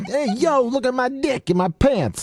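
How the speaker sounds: high Italian New Yorker voice; brash Brooklyn tough guy; Vinnie Barbarino impression.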